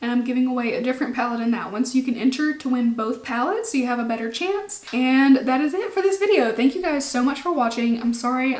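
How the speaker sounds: ordinary talk, nothing marked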